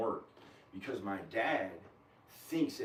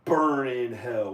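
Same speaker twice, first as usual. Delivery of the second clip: loud, drawn-out damning curse; dad's curse; 9 dB louder, much slower than usual. Retold or imitated. Quoting dad's curse at that person, loud and drawn out. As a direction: imitate dad damning someone, slow and forceful. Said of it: "as my dad says to that same person".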